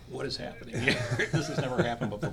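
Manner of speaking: amused boss, laughing in disbelief. Retold or imitated. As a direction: laugh through the words, amazed.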